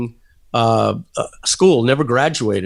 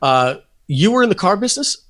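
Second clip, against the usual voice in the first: lightly imitated Australian accent, higher pitch; 7 semitones higher than usual. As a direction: quote him in a hint of Australian. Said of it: "in his beautiful Australian accent, he says".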